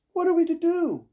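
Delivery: shrill up in alarm as the light dies; stepsisters crying out. Acted. Stepsisters crying out in the dark, loud and high. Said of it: "cried the stepsisters".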